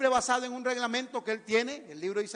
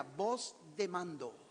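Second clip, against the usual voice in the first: solemn; 3 semitones lower, 8 dB quieter, slower than usual. Real, not acted. Intoning the Bible's description of voice.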